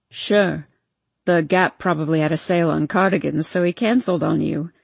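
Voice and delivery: sarcastic drunk mockery. Mocking Noah.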